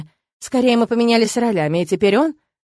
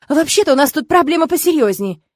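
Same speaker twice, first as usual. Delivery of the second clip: higher, louder, pressing demand; urgent and exasperated, voice raised; impatient exclamation; 6 semitones higher, 5 dB louder than usual. A narrator's reading. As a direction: press him impatiently for an answer. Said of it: "нетерпеливо воскликнула я".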